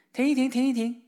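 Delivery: high, loud hail to the cart; calling out to stop. A narrator's reading. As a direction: flag them down from afar. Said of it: "他俩喊道". (大声高喊停车)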